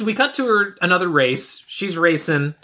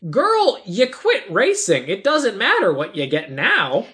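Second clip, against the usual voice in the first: slow, high-pitched mock telling-off; exasperated scolding; 4 semitones higher, slower than usual. Viewer scolding the racer who quit, mock exasperated. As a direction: stretch it out, hammed-up incredulity. Scolds the film's heroine, drawn out.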